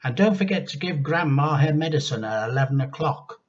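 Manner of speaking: mother's voice. Acted.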